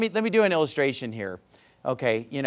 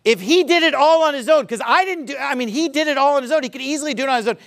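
normal talking voice

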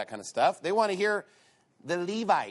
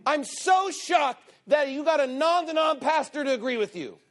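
higher, louder mock amazement; sarcastic shock; 9 semitones higher, 3 dB louder than usual. Preacher sarcastically answers the boasting churchgoer, mock amazed. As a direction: pile on the sarcasm.

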